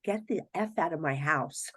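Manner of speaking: hissed whisper to the ghost; whispered order. Retold or imitated. Telling the ghost to leave, urgent whisper. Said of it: "I said".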